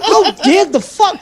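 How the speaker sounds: high, slow and laughing; mock indignation. Man quoting his shock on the metro, high laughing squeal. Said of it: "I felt like".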